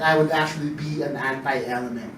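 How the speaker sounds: usual speaking voice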